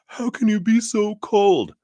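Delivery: high, loud disbelieving cry; shrill up in outrage at the coldness; shocked high accusation. Retold or imitated. Partner accusing the speaker, high and loud. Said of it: "And they were like".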